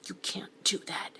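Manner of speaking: whispered "You can't do that"; hushed so his son won't hear; an urgent breathy whisper. Acted. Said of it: "so he lowered his voice".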